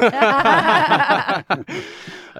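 loud, high burst of laughter; long high cackling laugh; big laugh. Real, not acted. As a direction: crack up loudly at being turned down. Guest refuses to tell, host laughs.

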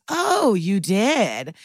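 drawn-out, swooping incredulous question; mock surprise. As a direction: feign astonishment, stretching the words out slowly. Acted mock-surprised reply to a confession.

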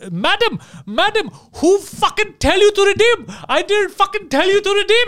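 high-pitched mock outrage; hammed-up indignant screech; scammer going crazy. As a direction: lose it like a frantic phone scammer. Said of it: "And they're going crazy".

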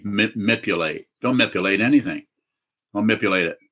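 "manipulate" said as mip-yoo-late, dropping the N; deliberately mispronounced. Shown as a demonstration. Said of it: "I'm gonna mispronounce the word".

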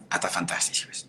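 a voiceless whispered aside. Real, not acted. Vlogger whispers a side remark to her viewers. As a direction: drop into a whisper, almost to yourself.

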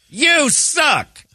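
high, hollered taunt; retold heckler's shout, pitch way up; screamed heckle. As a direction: yell it at the booth. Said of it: "And I screamed out".

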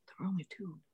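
hushed whisper, barely any voice; whispered aside. Real, not acted. Podcast guest adding a joking aside to the host, whispered. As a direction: slip it in under your breath.

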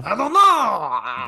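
high, slow French bunny voice; rabbit French accent. Pretend. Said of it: "Rabbit French".

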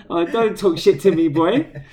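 playful mock scolding, laughing underneath; mock telling off. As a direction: bark it at the kid in jest. Mock telling off his son.

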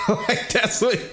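high-pitched laugh through words. Real, not acted. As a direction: break into laughter mid-sentence. Laughing at own old-age routine.

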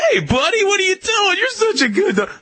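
high, hammed-up gushing hey buddy greeting; overexcited dog petter. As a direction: imitate someone squealing over the dog. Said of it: "who goes".

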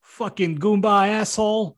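high, slow Jersey tough guy; Jersey accent. Acted. Reading Alan's part at the door, Jersey accent. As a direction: play it with a mock Jersey accent. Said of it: "he has a Jersey accent".

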